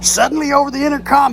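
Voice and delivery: normal talking voice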